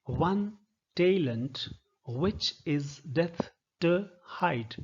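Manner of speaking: slow, measured verse line with TO reduced to "tuh"; connected-speech demo, weak TO. Shown as a demonstration. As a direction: recite slowly, TO as a short "tuh". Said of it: "not to".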